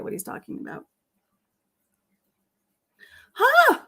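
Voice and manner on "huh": lie sweetly, playing dumb; pretending not to know; fake innocence. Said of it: "I lied".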